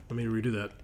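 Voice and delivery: ordinary talk, nothing marked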